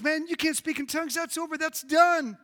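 high, dismissive verdict; mock outrage. Preacher mimics objector to speaking in tongues, mock outraged. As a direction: shut it down in a high-pitched voice.

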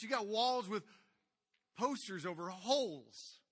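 loud, high exhortation at full force; fiery preaching. Acted. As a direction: drive it home from the pulpit. Preacher rebukes congregation.

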